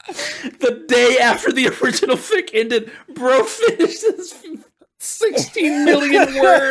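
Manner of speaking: high, giggling read; barely holding it together, laughing; laughing through words. Real, not acted. Laughing while reading fanfic stats.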